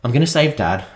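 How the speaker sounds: a light mock of a cooing mother-to-be; mock tender mum. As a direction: imitate her talking sweetly to the baby. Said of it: "she's like".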